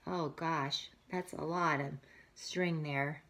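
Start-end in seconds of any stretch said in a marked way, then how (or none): none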